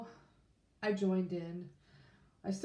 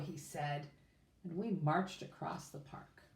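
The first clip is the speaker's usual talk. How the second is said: plain reading voice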